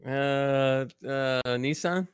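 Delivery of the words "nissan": Nissan as NEE-sahn, long open second vowel; American pronunciation. Real, not acted. American answering a British co-host's car quiz, American pronunciation. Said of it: "Americans pronounce all Japanese cars wrong".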